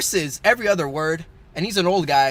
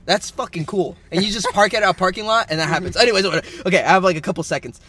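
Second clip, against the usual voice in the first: louder, upbeat newscaster delivery; mock news read; 4 semitones higher, 7 dB louder than usual. Host reads silly job news to co-host, mock newscaster. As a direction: hype it like a news anchor racing a timer.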